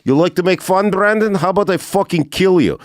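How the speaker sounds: high-pitched imitation of a threatening Russian; mock an angry Russian picking a fight; menacing Russian accent. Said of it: "one time he was like".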